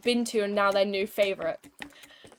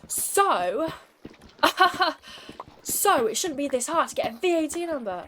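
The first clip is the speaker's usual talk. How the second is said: lightly voiced pushy customer; 6 semitones higher, 4 dB louder than usual. Angry customer voiced in story.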